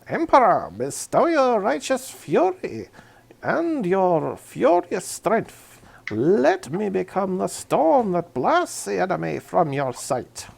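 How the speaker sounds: loud, high, slow battle prayer; commissar voice. Acted. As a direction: bellow it like a fiery commissar rallying troops. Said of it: "in my best commissar voice".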